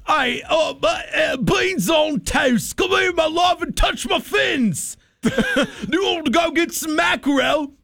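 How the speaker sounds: radio host playing a British whale, shouting high in a hammy British accent. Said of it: "let's do British".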